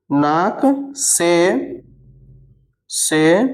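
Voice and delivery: very slow, word by word dictation with long pauses; slow, spaced-out dictation to a class. Shown as a demonstration. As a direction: dictate each word slowly so students can write it down. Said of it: "आप बताइएगा उच्चारण".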